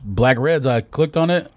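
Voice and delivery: ordinary delivery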